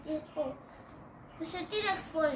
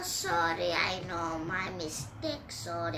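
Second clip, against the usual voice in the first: usual speaking voice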